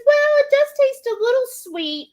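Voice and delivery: high mock-mom voice, grudging admission; imitating mom. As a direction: squeak it out like a mother caught out. Mom admitting coffee tastes sweet.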